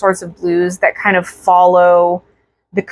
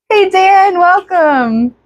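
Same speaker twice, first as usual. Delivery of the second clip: bright high hello; excited greeting; 9 semitones higher, slower than usual. Real, not acted. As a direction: call out a cheerful welcome. Greeting chat viewer.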